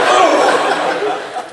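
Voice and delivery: high, breathless mock yelp; a laughing, airy, whispered cry of dismay; mock exclamation. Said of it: "I'm like".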